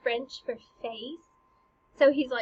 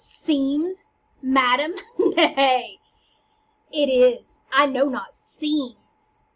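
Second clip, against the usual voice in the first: loud, indignant Hamlet retort; pouncing on the word; 7 dB louder, much slower than usual. Acted. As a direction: jump on "Seems" and throw it back. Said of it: "jumps on this".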